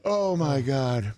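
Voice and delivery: worn out from real laughing. Real, not acted. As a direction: exhale the words while still cracking up. Host recovering from laughter.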